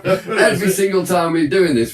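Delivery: ordinary talk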